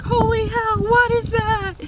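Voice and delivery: high, loud alarmed shriek; freaking out. Retold or imitated. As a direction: yelp it in shock. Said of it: "freaking out".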